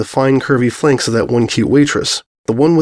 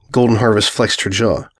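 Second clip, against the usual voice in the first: ordinary delivery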